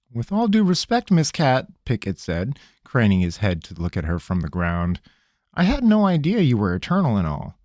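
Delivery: a cornered trickster pleading; nervous country rogue. Acted. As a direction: squirm and stall, talking fast. Pickett voice.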